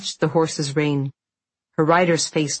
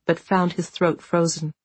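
ordinary delivery

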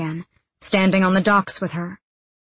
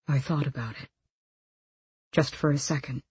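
low, soft admission; quiet confession; 3 semitones lower, 7 dB quieter than usual. A narrator's reading. Man admitting he nearly let go, quiet confession. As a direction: confess it softly, pitched down.

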